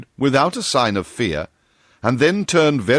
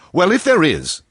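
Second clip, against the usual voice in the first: impatient; 9 semitones higher, 4 dB louder than usual. A narrator's reading. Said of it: "said Bull impatiently".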